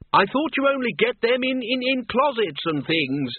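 high shouted question over the noise. A narrator's reading. Ridcully shouts a question to Windle on the trolley. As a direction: yell across the speeding trolley. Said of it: "shouted Ridcully".

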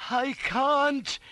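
high, loud strained effort; straining. A narrator's reading. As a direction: force it out while heaving a weight. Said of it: "he whispered, straining away".

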